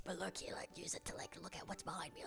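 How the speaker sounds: secretive whisper; whispered conspiratorially, unvoiced. Secret plan told in whisper.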